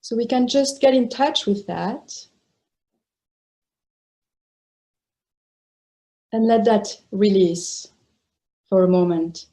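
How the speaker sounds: sparse phrases parted by long silences; guided meditation. Real, not acted. Teacher inviting meditators to release the body, spacious guided meditation. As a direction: let each phrase rest before the next.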